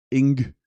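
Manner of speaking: "Ing" said as one syllable rhyming with sing; name pronounced Ing. Shown as a demonstration. Guessing a player's name aloud, "Ing" said like sing. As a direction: say "Ing" short, just the ng sound with an i. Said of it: "I think it's pronounced Ing".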